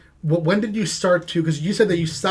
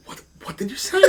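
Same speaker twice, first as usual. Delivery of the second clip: stunned; 6 semitones higher, 5 dB quieter, faster than usual. Retold or imitated. Teacher's stunned reply, retold with laughter.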